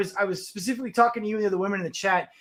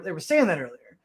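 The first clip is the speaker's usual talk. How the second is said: ordinary delivery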